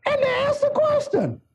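high, stretched pulpit cry; full-force preaching. Acted. Preacher voicing devils who question Jesus, full-force preaching. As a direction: call out each phrase high and separate.

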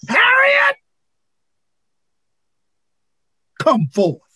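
one sharp high cry of the name; ringing call. Acted. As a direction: call her out by name like a summons from heaven. Preacher voices God calling Harriet.

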